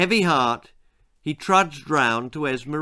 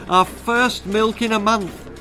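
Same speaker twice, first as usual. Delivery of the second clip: cried out; 7 semitones higher, 3 dB louder than usual. A narrator's reading. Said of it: "she cried".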